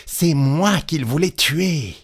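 slow, high-pitched realization; indignant exclamation. A narrator's reading. Petit Claus realizing he was the target, exclaiming. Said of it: "s'écria Petit Claus".

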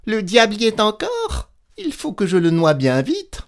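high-pitched show of alarm; feigned fright. A narrator's reading. Petit Claus pretending the devil scares him, hammed-up fright. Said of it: "feignant de s'effrayer".

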